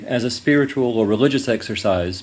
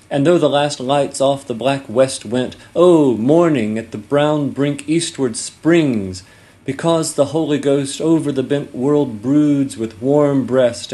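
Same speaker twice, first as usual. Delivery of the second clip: louder, higher dramatic recitation, slowing on key lines; poem recitation; 4 semitones higher, 5 dB louder, slower than usual. Shown as a demonstration. Professor reads a Hopkins sonnet aloud to the class, loud dramatic recitation. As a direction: declaim the sonnet in a full ringing voice, savoring each phrase. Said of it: "goes like this".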